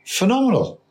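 amazed. Real, not acted. Marvelling at twenty-year career.

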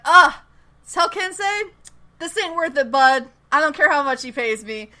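loud, spooked brush-off; fleeing hired man. Retold or imitated. Hired fake Kensei quitting in a panic, loud mock brush-off. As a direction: bail out of the job in a panic. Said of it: "the guy is just like".

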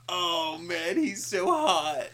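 high, drawn-out gushing over a crush; hammed-up loud, lovestruck squeal; mock swooning. Friend voices the movie heroine falling for the guy, mock swooning.

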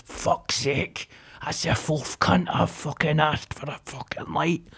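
whispered, seething under his breath; muttered under breath. Retold or imitated. Stranger grumbling about being refused a light, whispered. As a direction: hiss it out through your teeth, barely voiced.

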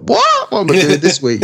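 a baffled actor squeaking out what's this week; bewildered surprise. Retold or imitated. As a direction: pitch leaps sky-high on What, then rushes on puzzled. Confused actor reading new audition.

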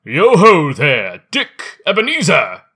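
put-on loud, hearty jolly old boss; jovial fat voice. A narrator's reading. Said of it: "called out in a comfortable, oily, rich, fat, jovial voice".

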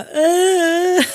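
sudden high, loud broken cry; choked-up cry. Retold or imitated. Asked how she is, she breaks down crying. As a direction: let it crack into a sob.

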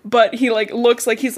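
ordinary delivery

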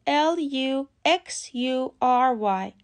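letters spelled out very slowly; slow spelling. Shown as a demonstration. Teacher spelling LUXURY slowly. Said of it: "لغت بعدی".

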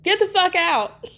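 high-pitched mock scream; shrieky play-acted yell, not full volume; retold scream. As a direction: send the voice way up, imitating a screamed order. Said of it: "she's screaming at Ashley".

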